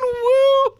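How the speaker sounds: high shouted mock whoop; an imitated gleeful war cry; whooping woo. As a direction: whoop like she's partying. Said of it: "Just going".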